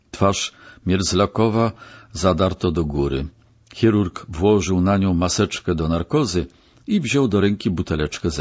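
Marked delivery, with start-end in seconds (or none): none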